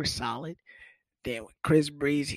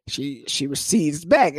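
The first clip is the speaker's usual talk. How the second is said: usual speaking voice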